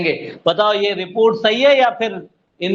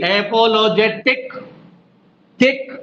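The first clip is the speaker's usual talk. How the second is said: Apologetic slow, rising to a punched टिक; drawn-out apologetic with टिक snapped; stressed last syllable; slower than usual. Shown as a demonstration. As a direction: hit टिक hard, then repeat it alone. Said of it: "जीभ पे जोर पड़ रहा है".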